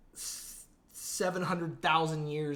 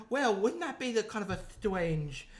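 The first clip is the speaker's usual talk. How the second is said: mock British accent, lisped S's and W-like R's; hammed-up British lisp; 5 semitones higher than usual. Co-host imitating a strange British accent, lisping. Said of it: "they have a lisp and they can't say their R's".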